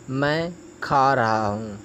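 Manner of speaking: normal talking voice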